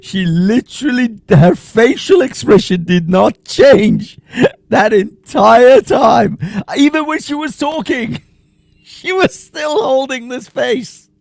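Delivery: high-pitched, cracking up while talking; real laughing. Real, not acted. Reactor points out the woman's unchanged face, laughing helplessly. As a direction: squeeze the words out through laughter.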